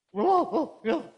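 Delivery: high, loud startled cries; jolted out of sleep, yelping in alarm; startled awake. Acted. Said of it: "if you're falling asleep".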